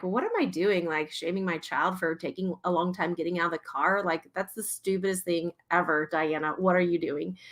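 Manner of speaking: soft, low-key telling-off of oneself; murmured inner voice, chiding; self-reproach. Retold or imitated. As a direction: quietly scold yourself.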